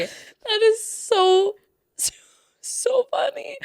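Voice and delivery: very high, drawn-out words through laughter; cracking up. Real, not acted. Co-host reacting to the botched impression, real helpless laughing.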